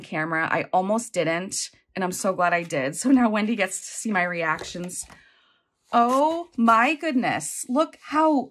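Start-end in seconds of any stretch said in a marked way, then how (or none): 5.9-8.5 slow, drawn-out gasp of delight; overwhelmed awe. Real, not acted. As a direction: let the words melt out slowly. Overwhelmed by the gift.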